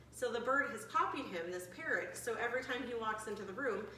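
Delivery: normal talking voice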